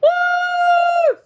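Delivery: high loud whoop of joy; high, loud triumphant woo; joyful howl. A narrator's reading. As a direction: let out a proud victory howl. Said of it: "howled with joy".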